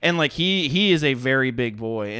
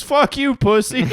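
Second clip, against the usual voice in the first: much higher, loud jeering "fuck you, pussy"; mock aggressive insult; 10 semitones higher, 3 dB louder than usual. Retelling the drunk stranger's insult, high mock jeer.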